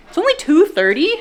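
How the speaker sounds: high, loud disbelief at the time; amazed exclamation. Real, not acted. Telling viewers how early it is, loud and amazed. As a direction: marvel at how early it still is.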